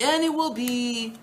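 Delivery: high, drawn-out solemn opening; proclaiming scripture. Acted. Teacher quoting Torah blessings to the class, proclaiming. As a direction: intone it like a grand proclamation. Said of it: "what the blessings and curses sound like".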